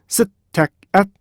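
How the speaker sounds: 셋, 택, 앳, 음, 제 as five separate beats; staccato syllable-by-syllable demo; syllables split apart. Shown as a demonstration. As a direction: break it into five evenly timed syllables. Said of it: "이렇게 따라해 보세요".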